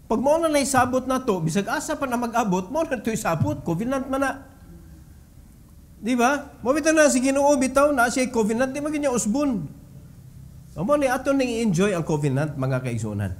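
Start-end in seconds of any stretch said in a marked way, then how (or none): none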